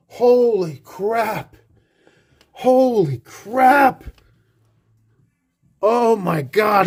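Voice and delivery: high, very slow, stretched-out "Holy crap"; awed exclamation. Real, not acted. Collector staring at his new puck, awed and stretched out. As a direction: let the awe pour out slowly.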